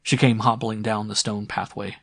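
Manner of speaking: usual speaking voice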